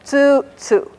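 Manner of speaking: first tsu high, second tsu low; high then low pitch. Shown as a demonstration. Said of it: "This one is high and this one is low".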